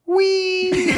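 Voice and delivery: long, high-pitched "wee" squeal; drawn-out mock squeal of glee; high gleeful squeal. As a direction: imitate her delighted joyride squeal. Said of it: "she made this sound".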